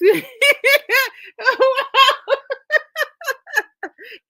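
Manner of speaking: a loud, high fit of laughter; long laughing fit. Real, not acted. Host losing it over a viewer's joke, long loud laugh. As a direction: lose it completely.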